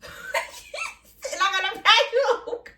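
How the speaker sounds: high, slow, breaking into laughter; helpless laughter through the words; laughing mock quote. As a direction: act it out while cracking up. Cracks up acting out club scene.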